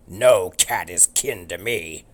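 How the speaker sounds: loud, slow dog bellow. Acted. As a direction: roar it slowly like a big dog. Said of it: "Turbo bellowed".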